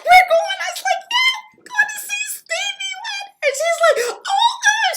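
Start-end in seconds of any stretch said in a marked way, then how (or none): 0.0-0.6 very high, loud mock squeal; retold excitement. Mom learning of the surprise concert, excitedly squealing in imitation. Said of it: "she got all excited". 1.1-3.4 very high squealed reveal; re-enacted excitement. Daughter telling mom about [name], excitedly squealing. 4.1-4.9 high, loud imitated squeal; retold excitement. Mom hearing about Stevie Wonder, mock squeal of excitement. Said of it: "she got all excited".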